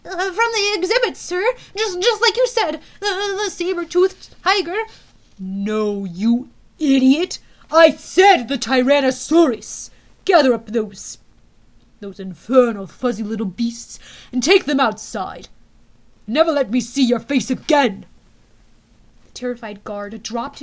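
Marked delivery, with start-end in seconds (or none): 0.0-4.8 higher, stammering frightened guard; cowering. Acted. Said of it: "cowered in fear". 5.4-18.1 loud furious tyrant; ranting dictator speech. Acted. General berates guard.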